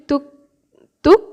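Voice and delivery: তুক with a tight double-like t; tense ত. Shown as a demonstration. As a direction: release the t of তুক firmly. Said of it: "ত যে আমি উচ্চারণ করছি". (তুক-এ জোড়া ত-এর উচ্চারণ)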